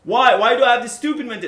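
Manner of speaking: loud fast exasperated rant; loud self-scolding. Real, not acted. Scolding himself to viewers for stealing, loud and fast. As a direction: fire it at yourself in frustration.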